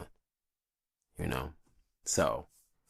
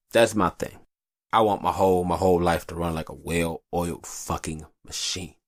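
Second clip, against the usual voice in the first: usual speaking voice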